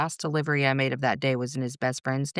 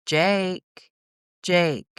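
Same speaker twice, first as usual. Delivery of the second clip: higher-pitched call of a name; 4 semitones higher than usual. Retold or imitated. Mother retelling calling her son at his door, calling out. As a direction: call through a closed door, retold.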